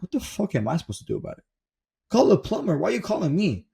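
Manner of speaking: high-pitched incredulous complaint; exasperated boss. Retold or imitated. Gym owner to employee calling about a leak, exasperated.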